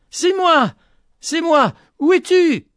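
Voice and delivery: high, loud calling out; frantic cry. A narrator's reading. Petit-Jean searching through smoke, high frantic cry. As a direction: shout for someone lost in the smoke. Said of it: "en criant".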